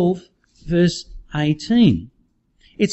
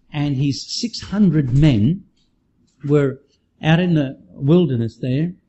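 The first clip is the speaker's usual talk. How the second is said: ordinary delivery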